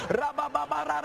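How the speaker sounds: high, rattling stream of syllables; reenacted burst of prayer language; imitated tongues. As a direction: let the babble flow out up high. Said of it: "let that baby flow".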